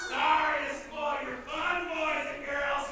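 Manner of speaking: loud villain taunt; mock the kids, villain-style.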